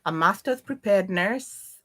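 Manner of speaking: ordinary talk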